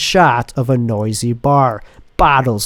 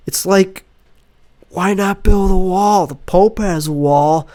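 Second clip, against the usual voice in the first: higher, pushing the punchline; hammed-up comic; 6 semitones higher than usual. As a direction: sell a bad joke to a cold crowd. [name]'s pro-Trump stage bit.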